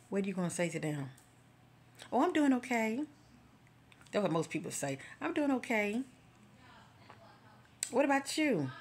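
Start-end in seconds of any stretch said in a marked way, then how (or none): 2.1-3.0 high-pitched, louder stock answer; acted polite reply. What most people say back, high-pitched and put-on. 5.2-8.7 high, put-on small-talk voice; acted polite reply. Small talk with people encountered, high-pitched and put-on.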